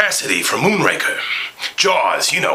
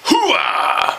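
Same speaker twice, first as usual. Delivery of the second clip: hooah shout. Acted. Pacino catchphrase shout.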